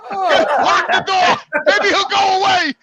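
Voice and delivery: loud, high mock-panicked warning, laughing at the end; panicked old neighbor. Imagined old neighbor warning Ralph about the maniac, hammed-up panic. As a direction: play the scared neighbor hammed up. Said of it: "old people saying".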